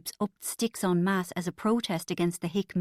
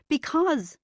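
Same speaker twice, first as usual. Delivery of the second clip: higher, breaking; convulsing with laughter; 8 semitones higher than usual. Acted. Bridget convulsing with laughter, starting the punchline. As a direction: force it out mid-laugh. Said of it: "convulsed Bridget".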